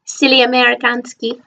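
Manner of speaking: jokey high Slavic-tinged "Amerikanski"; playful fake Eastern European lilt; mock foreign accent. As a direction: toss off Amerikanski in a silly put-on accent. Self-mocking foreign word.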